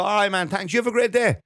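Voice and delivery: high, bright over-friendly farewell; overly cheerful thanks. Retold or imitated. To the patient at the desk, over-cheerful thanks. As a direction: pile on the cheer to leave politely. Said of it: "I go".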